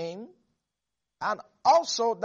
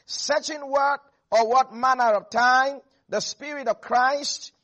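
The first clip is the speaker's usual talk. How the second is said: fiery preaching; 2 semitones higher, 4 dB louder than usual. Acted. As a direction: build the sermon up to full force before the congregation. Preacher builds to full force.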